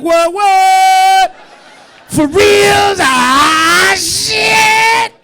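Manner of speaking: high, loud, overjoyed yelling; thrilled shout. Retold or imitated. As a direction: shout with delight, flattered. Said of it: "You're gonna be like".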